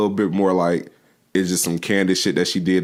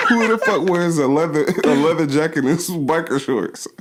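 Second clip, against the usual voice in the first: laughing; 8 semitones higher than usual. Real, not acted. Laughing while recalling.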